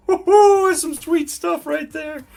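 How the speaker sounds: high, loud woo-hoo then gleeful drawl; jubilant cheer. Real, not acted. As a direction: whoop with joy over the news. Celebrating JSN freed up.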